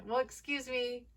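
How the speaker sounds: sarcastic mock offense; indignant. Real, not acted. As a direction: scoff at her hypocrisy. Indignant retort.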